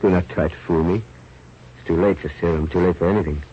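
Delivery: resigned dying. Acted. Dying man refuses serum.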